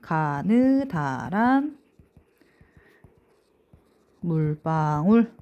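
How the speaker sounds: slow, syllable-by-syllable dictation; deliberate, drawn-out mnemonic; memorization phrase. Shown as a demonstration. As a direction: stretch each syllable for memorizing. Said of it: "요거 어떻게 외우냐면".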